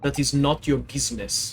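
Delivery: put-on broken English in a heavy Russian accent; proud Russian crewman correcting the girl; gruff Russian sailor. Sailor to the maid, Russian accent, offended.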